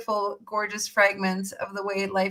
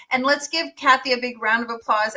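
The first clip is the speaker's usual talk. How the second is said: ordinary talk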